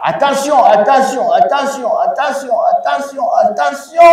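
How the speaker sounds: high-pitched, rapid-fire teacher's alert; insistent high-pitched caution; urgent warning. Real, not acted. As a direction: sound the alarm to the class, high and insistent. Urgent repeated warning to the class.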